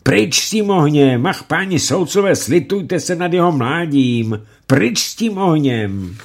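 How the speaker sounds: high, loud desperate screaming plea; mother screaming at the judges to take away the fire. Acted. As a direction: beg them to stop, frantic. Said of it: "křičela".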